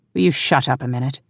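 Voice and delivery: ordinary delivery, nothing marked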